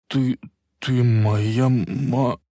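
slow, trembling and cut off mid word; shaky voice. A narrator's reading. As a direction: stammer it out, trembling. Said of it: "дрожащим голосом начал Марти".

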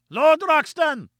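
slow, high, drawn-out call; shouting. A narrator's reading. Gomez shouting to Lord John across the chasm, long high call. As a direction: hail him across the abyss, stretched out. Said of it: "he shouted".

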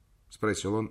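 ordinary delivery, nothing marked

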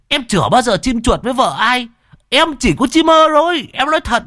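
high, loud squeaky denial; flustered cripple. Acted. Flustered Thọt denies eyeing the wife, high and squeaky. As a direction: panicky stammered protest. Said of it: "đỏ mặt run bần bật".

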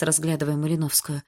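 usual speaking voice

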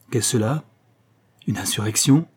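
plain reading voice

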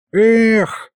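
disappointed little sigh; regretful sigh. A narrator's reading. Imp sighing that the fun stopped, regretful sigh. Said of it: "сожалеюще вздохнул бес".

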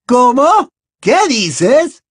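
very high, loud, alarmed questions; frightened shriek. A narrator's reading. Death Eater learning the prophecy is lost, frightened shriek. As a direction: shriek it in sudden fear. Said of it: "chilló la mortífaga... su voz transmitía miedo".

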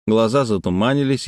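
ordinary delivery, nothing marked

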